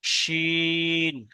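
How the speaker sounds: শিন with a thick, heavy sh sound; thick shin. Shown as a demonstration. Teacher showing a student how to say শিন with a thick sh. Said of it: "শিনটা মোটা করে উচ্চারণ করেন".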